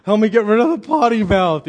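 retold cry for help to the Lord; pleading prayer. Preacher retelling his own bathroom prayers, pleading. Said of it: "I'd pray every time I went".

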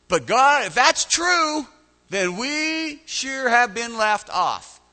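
loud, high, drawn-out protest; Gideon, sarcastic. Acted. Gideon protesting to God, loud sarcastic complaint. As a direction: stretch the complaint slowly and loudly. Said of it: "hear the sarcasm dripping out of his voice".

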